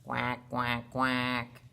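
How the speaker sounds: low, level duck quacks; low-pitched imitation of a duck; duck quacking. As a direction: quack three times like a duck. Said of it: "Mother duck is quacking".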